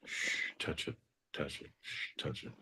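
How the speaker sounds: soft, sinking to whispered touch it; whispering to himself, fading away. Acted. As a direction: drop to a breathy hush. Sinking into a hushed bit.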